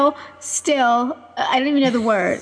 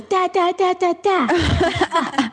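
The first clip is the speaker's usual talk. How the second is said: put-on higher, slow baby babble with a laugh; imitating baby babble; 6 semitones higher, much slower than usual. Daughter refusing to say mama, higher slow baby babble. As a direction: babble like a toddler, drawn out. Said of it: "she goes".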